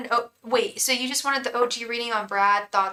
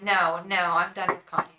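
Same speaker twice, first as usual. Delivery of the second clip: ordinary talk, nothing marked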